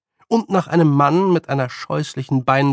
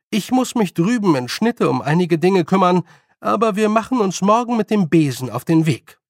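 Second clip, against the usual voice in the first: higher, louder decisive announcement; brisk old witch, announcing; 5 semitones higher, 5 dB louder than usual. Acted. Nanny tells apprentice tomorrow's plans, decisive.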